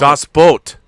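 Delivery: Das Boot said loud, with a German accent and a long o. Shown as a demonstration. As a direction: proudly show off German pronunciation. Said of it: "help me out".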